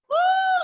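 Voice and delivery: high loud woo; excited whoop. Real, not acted. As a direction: let out a joyful whoop. Excited cheer in worship.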